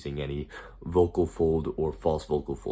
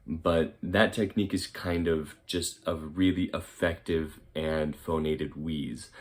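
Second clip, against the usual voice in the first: ordinary talk, nothing marked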